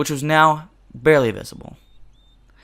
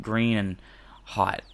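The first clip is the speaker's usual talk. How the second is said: normal talking voice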